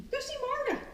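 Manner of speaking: high sing-song cue to a dog; cheery dog cue. Acted. Trainer cueing her dog, high sing-song. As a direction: sing it up high like talking to a puppy.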